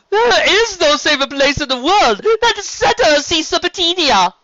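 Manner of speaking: high, loud, flamboyant serpent voice; sea serpent Stephen. Acted. Sea serpent inviting the sister along, grand and high.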